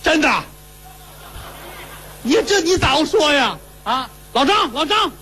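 high, laughing cry of joy; overjoyed shout. Acted. Lovesick son hearing good news, calling the steward in high excitement. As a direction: leap up and call out delighted. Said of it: "噌就蹦起来了".

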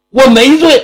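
high, loud, insolent retort; defiant denial. Acted. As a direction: throw it back at him with contempt. Criminal defies the magistrate. (犯人顶嘴，不服气)

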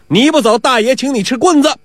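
acted irritated guard; threatening. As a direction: warn the thief off with a threat.

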